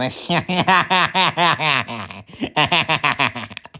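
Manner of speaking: long laugh in rapid pulses; staccato run of cackling laughter. Acted. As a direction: laugh at your own joke, in two bursts. Laughing at own jibe about rival.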